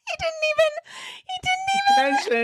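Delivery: high-pitched squeal of laughing speech; laughing hard. Real, not acted. Host reacting to the ambassador's quote, laughing too hard to talk. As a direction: squeak the words out through laughter.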